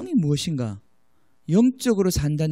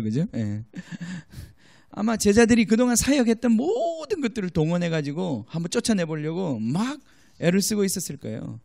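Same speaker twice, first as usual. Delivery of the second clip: ordinary talk, nothing marked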